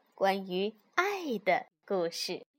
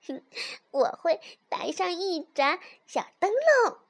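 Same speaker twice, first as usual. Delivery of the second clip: high little fox voice; giggly little fox; 6 semitones higher, 4 dB louder than usual. A narrator's reading. Little fox explains the lantern to mother, high and a touch giggly. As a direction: pitch it up like a pleased cub. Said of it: "小狐狸咯咯笑着说".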